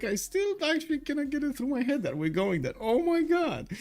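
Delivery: rising high and excited, can't believe it; excited disbelief. Real, not acted. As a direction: caster thrilled about the Paris trip, excited disbelief. Excited about going to Paris.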